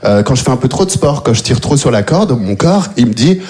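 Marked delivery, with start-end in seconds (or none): none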